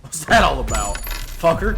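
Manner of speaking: loud annoyed outburst; angry yell. Real, not acted. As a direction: yell it at the screen. Cursing at shopkeeper character.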